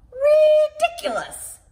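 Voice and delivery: barking dog. Acted. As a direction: bark the word out like a dog. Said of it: "barked the dog".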